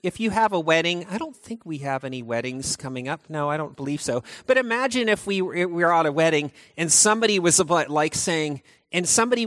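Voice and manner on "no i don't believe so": normal talking voice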